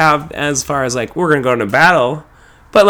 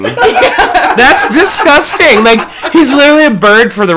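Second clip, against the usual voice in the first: That's disgusting drawn out very slowly, much higher, laughing; exaggerated laughing disgust; 10 semitones higher, 5 dB louder than usual. Shown as a demonstration. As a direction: stretch That's disgusting in a high laughing wail. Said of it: "repeats it exaggerated".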